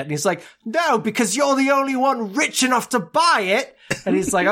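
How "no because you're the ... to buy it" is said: high, cheeky child imitation; mock street kid. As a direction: answer back like a little urchin. Voicing the boy answering Scrooge.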